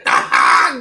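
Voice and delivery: big burst of laughter; loud cackling laugh. Real, not acted. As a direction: laugh out loud at your own joke. Laughs at own joke.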